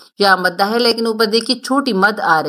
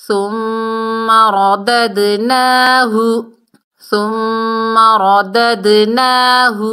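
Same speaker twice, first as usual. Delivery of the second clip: slow, drawn-out Quranic recitation; tajweed recitation; much slower than usual. Shown as a demonstration. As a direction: chant each word slowly like in tilawat. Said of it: "Quran recitation demo". (آہستہ تجوید کے ساتھ تلاوت)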